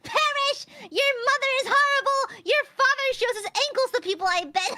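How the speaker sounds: high, loud mock insults; theatrical shout. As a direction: bellow the insults at the enemy. Mock taunting the enemy creature.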